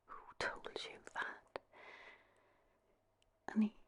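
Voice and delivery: ordinary delivery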